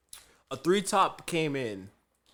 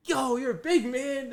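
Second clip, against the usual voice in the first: high-pitched impressed exclamation; mock gushing; 10 semitones higher than usual. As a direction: imitate a coworker fawning over someone. Kevin gushes on meeting the dad.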